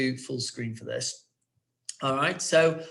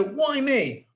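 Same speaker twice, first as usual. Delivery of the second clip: mock scared piglet; 10 semitones higher, 3 dB louder than usual. Shown as a demonstration. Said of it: "Okay, so".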